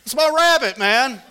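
high loud yell with a laugh at the end. Retold or imitated. Pastor yelling at a hawk over his rabbit, high and loud. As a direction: shout it at the hawk, half laughing. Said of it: "and I said".

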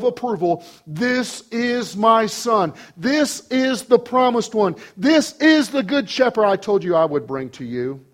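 high, measured proclamation; declarative preaching. Real, not acted. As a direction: announce it with divine authority. Preaches at full force.

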